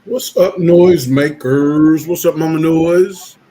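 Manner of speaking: big slow hype greeting, stretched out; loud greeting. Real, not acted. Loud drawn-out greeting to hosts.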